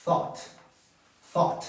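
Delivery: isolated THOUGHT with a th at the front; model pronunciation. Shown as a demonstration. Teacher shows learners the past of think, model pronunciation. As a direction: say THOUGHT as a careful single syllable. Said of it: "Y se pronuncia, eh, más o menos así".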